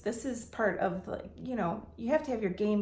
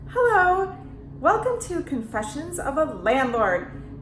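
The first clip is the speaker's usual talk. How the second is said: high, loud mock-cheery host greeting; mocking intro voice; 5 semitones higher, 6 dB louder than usual. Mom imitating her daughters mocking her intro. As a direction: lampoon your own perky intro. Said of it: "They make fun of me".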